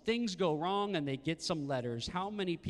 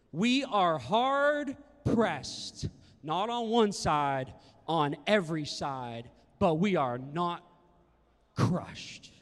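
very slow, loud, word by word; emphatic scripture reading; 5 dB louder, much slower than usual. Real, not acted. Preacher reading Second Corinthians to the church, slow and emphatic. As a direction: draw out each phrase with weight.

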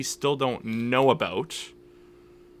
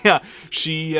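ordinary delivery

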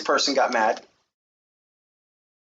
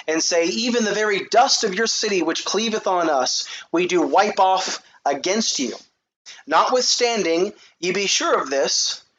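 raised, higher proclaiming read of scripture; 6 semitones higher than usual. Acted. Preacher reading Luke to his congregation, raised proclaiming voice. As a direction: declaim the verses to the congregation.

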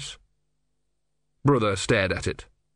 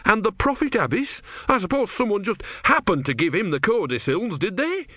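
high, indignant sarcasm; shaking with anger; 10 semitones higher than usual. A narrator's reading. Disciple confronting his god about a prophet, high-pitched anger. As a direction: challenge angrily, pitch climbing at the end. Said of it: "Brother was shaking with anger".

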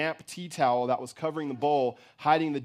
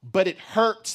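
loud, high-pitched protest; pained cry; 5 semitones higher, 6 dB louder, slower than usual. A narrator's reading. Wounded boy to his mother, crying out. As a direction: cry out from the hurt. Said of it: "he cried".

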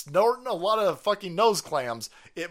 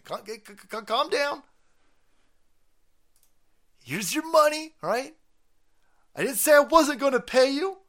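higher, flustered calm-down pleading; nervous placating; 6 semitones higher, 3 dB louder than usual. Acted. Rich son calming an angry escort, lightly voiced higher pleading. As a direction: stammer and soothe, a bit high.

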